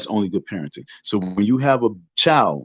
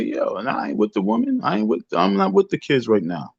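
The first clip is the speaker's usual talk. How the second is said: mock absent father; 8 semitones higher than usual. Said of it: "all you have to do is say".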